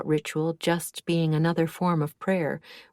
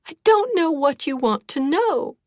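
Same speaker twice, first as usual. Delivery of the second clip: sobbing; 10 semitones higher, 5 dB louder than usual. A narrator's reading. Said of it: "she sobbed".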